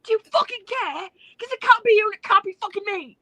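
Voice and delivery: high, loud British rant with mate; hammed-up Ospreay lecturing his rival; Ospreay impression. Co-host voicing Ospreay at Swerve, British accent. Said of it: "an Ospreay impression".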